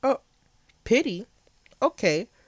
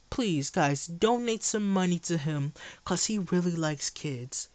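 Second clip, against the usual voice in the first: mock streamer; slower than usual. Mocking streamer crowd appeal.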